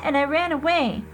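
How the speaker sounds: put-on a small boy telling mommy about nightmares; little boy voice. Little brother telling mom, high kid voice.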